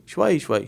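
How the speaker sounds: شوی said shwai, the final a dropped; شوی clipped to shwai; spoken form shwai. Shown as a demonstration. Teacher shows how locals say شوی, as shwai. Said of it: "ایسے بولتے ہیں".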